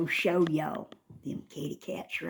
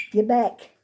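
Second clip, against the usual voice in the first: usual speaking voice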